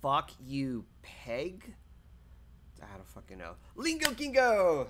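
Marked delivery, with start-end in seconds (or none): 3.8-4.9 high, loud, slow sing-song call; playful high greeting; excited greeting. Real, not acted. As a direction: call out the name with glee. Greeting a chatter.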